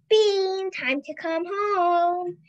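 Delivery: slow, drawn-out call from the porch; mother calling her daughter in for the day; mom calling. A narrator's reading. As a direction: call the child home from afar. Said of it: "Bean's mom calling from her porch".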